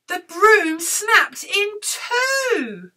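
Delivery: slow, high, loud climax; dramatic reveal. A narrator's reading. Storyteller reveals broom breaking, dramatic slow reveal.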